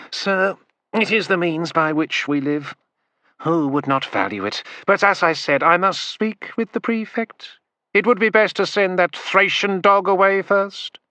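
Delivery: high, oily fawning merchant voice; bow and scrape as the merchant. A narrator's reading. Merchant bowing to the prefect, fawning voice.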